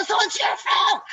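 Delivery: loud, high-pitched yell of blame; screaming. Retold or imitated. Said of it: "And I'm screaming at him".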